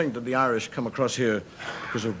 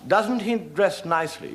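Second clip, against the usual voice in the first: prim lady; 7 semitones higher, slower than usual. Retold or imitated. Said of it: "She said".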